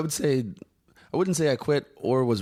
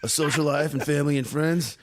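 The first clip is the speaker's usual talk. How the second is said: mocking whine, slightly higher; whiny fake voice; 5 semitones higher than usual. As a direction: keep whining it in mockery. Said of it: "the whiny fake voice".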